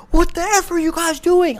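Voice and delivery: high, incredulous cop yelling; angry cop. Retold or imitated. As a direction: demand it like a furious officer. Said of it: "they're like".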